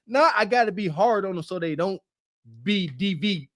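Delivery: normal talking voice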